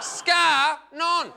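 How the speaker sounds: high, loud "Scar... non" in two beats; two-syllable slang. Shown as a demonstration. Comic repeating his shortened greeting, "Scar non" in two loud beats. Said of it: "I'll knock that off in two".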